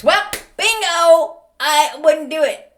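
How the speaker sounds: loud, high, slow triumphant bingo; gleeful loud gotcha; excited bingo. Real, not acted. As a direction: land the punchline big. Punchline, much louder.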